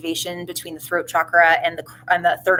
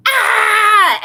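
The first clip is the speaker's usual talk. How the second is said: high, loud blaring yell like a horn; mock scream; 10 dB louder than usual. Voicing the viewer's fears as a loud horn-like scream. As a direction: blast out a fearful shriek. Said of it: "they're saying it's like a horn".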